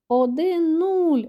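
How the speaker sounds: high, loud, drawn-out cheer; joyful shout. A narrator's reading. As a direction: cheer the score slowly and loudly. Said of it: "радісно кричать малюки". (радісний протяжний вигук)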